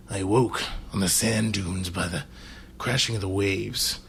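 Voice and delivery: quiet, low epic storyteller voice; audiobook narration. Pretend. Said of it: "I'm hearing a book on tape".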